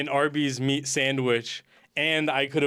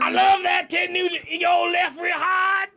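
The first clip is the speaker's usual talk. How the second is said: very high squealed put-on voice; 12 semitones higher, 4 dB louder than usual. Friend playing a [name] fan, high squealing impression. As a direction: gush in a high hammed-up rally holler.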